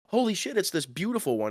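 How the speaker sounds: a pitched-way-up "Holy shit" of amazement; surprised delight. Retold or imitated. Driver seeing the beautiful parking garage, amazed. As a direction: gasp at how nice it is. Sees a fancy parking structure.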